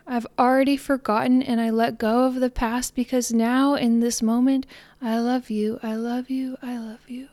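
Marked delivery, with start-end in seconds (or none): 5.0-7.3 I love you getting softer until the last is whispered; fading mantra. Shown as a demonstration. Closing her thought with a mantra, fading to a whisper. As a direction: trail each one away into a whisper.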